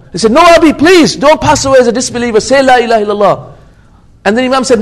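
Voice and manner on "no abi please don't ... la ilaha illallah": high, loud opening plea, easing off; worried pleading. Retold or imitated. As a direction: imitate a worried son begging his father. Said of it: "I became so worried".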